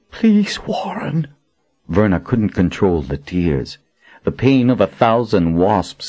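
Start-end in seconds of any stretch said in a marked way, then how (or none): 0.1-1.3 slow, whispered tearful plea. Acted. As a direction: beg him in a breaking whisper. Verna pleading through tears.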